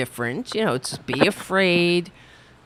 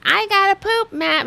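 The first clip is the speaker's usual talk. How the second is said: much higher, dopey mocking DeSantis; 13 semitones higher than usual. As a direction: squeak it out like a clueless kid. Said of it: "he's like".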